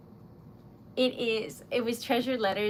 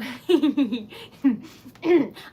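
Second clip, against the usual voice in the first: cackle; 3 semitones higher, 6 dB louder than usual. Real, not acted. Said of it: "surprises make me, like, cackle".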